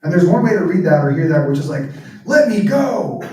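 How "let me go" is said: higher, sharp angry cry; mild mock-angry outburst. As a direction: snap it like an angry wrestler. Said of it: "Like this angry".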